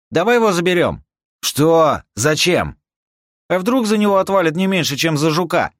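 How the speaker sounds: very high, loud shouts; calling out. Acted. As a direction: call out across a distance. Calling across the field.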